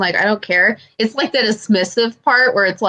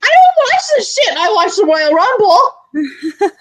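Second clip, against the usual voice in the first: high, loud put-on voice; mocking brother's wife; 10 semitones higher, 4 dB louder than usual. Imitating brother's wife about Royal Rumble, high mocking voice. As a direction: mimic her high up, stretching the last words. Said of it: "she's like".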